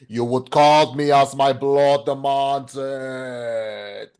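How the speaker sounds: slow, menacing vampire count imitation; Orlok's voice. Orlok correcting Hutter, slow and menacing. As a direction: drag each word out like an ancient undead lord demanding respect. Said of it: "and he goes, like".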